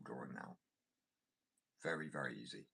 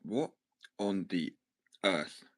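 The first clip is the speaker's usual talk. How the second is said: loud, halting disbelief; stunned, each chunk punched out loudly; real astonishment; 2 semitones higher, 12 dB louder, much slower than usual. Real, not acted. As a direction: pause between the words, baffled and loud. Stunned by the long Welsh sign.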